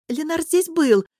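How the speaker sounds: high, emphatic assurance; assuring. A narrator's reading. Nesha tells Gota her husband was here, assuring. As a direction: assure her loudly, pitch lifted. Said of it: "заверила её Грата Неша".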